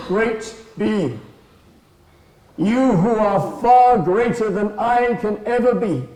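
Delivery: high, loud declamation to a great being; mouse, solemnly offering. Acted. Little mouse offers his eye to the buffalo, high and solemn. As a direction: proclaim it high and loud, with weighty pauses.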